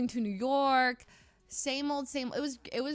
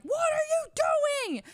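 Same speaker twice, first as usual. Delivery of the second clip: high, loud mock road-rage shout; road-rage yell; 8 dB louder, faster than usual. New York driver yelling at us through his window, road-rage shout. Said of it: "yelling at us through his window".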